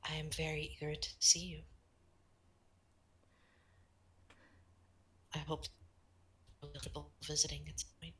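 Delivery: soft kind voice fading to a whisper; gentle whisper. Acted. Eve greeting shy Maris, gently whispered. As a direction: welcome the little one tenderly.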